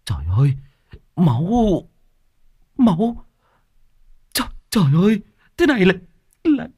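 high, stammering panic; involuntary shocked exclamation. A narrator's reading. Đồn sees blood on his hand, stammering shock. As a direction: blurt it out in horror, stumbling over words. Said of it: "thốt lên một cách vô thức".

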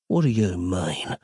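low, restrained growl; understated low growl; inward growl. A narrator's reading. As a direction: growl it under control, pitch dropped. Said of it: "Nick growled inwardly".

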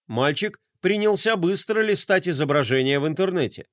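ordinary delivery

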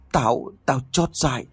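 high, faltering stammer; guilty old man stammering. Acted. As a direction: stumble over the first words in shame. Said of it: "lắp bắp kể lại".

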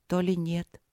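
ordinary delivery, nothing marked

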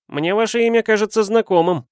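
puzzled student, pitched well up; frowning student. A narrator's reading. Frowning student to the detective whose name sounds familiar, puzzled and high-pitched. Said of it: "нахмурился студент".

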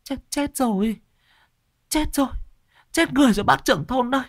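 high, halting frightened gabble; panicked gasping. Acted. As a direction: stammer out the bad news in fright. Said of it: "nói câu được câu trăng". (giọng hoảng sợ nói lắp bắp)

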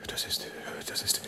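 breathless whisper, words failing; a faint whispered stammer; stunned whisper. Acted. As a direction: choke on the words in shock. Official stammers, caught out.

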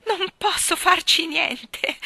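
high, loud, slow shriek of laughter; Michael rolling on the floor, shrieking. Acted. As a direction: squeal it out helplessly. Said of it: "strillò Michael".

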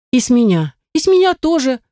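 high-pitched chiming in, voicing several girls; eager agreement. A narrator's reading. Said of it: "подтвердили все другие".

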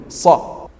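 unvoiced صا with tongue pressed to the palate; voiceless emphatic صا held against the palate; whispered sad sound. Shown as a demonstration. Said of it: "اللسان يلتصق مع الحنك العلوي".

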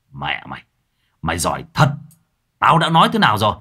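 cold, menacing scolding, rising at the question; grim anger. Acted. Mặc scolding the demon after whipping the corpse, angry. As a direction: threaten the corpse with barely held rage. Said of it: "mặt mũi đã sầm lại".

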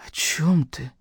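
soft, breath-only question; weak whisper. A narrator's reading. Injured brother puzzled by his sister's promise, weak whisper. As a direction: ask faintly, without voice.

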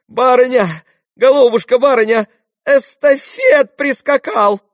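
very high, loud, excited calling; elated servant's shrill exclamations; joyful breathless cry. A narrator's reading. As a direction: burst in shouting the happy news. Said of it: "восклицала она весело и запыхавшись".

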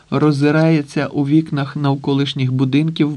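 plain reading voice

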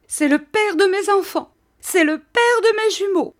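high, loud hysterical wail; crying out. A narrator's reading. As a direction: protest frantically at the top of the voice. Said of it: "s'écria mistress Micawber en se débattant".

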